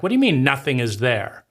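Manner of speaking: ordinary delivery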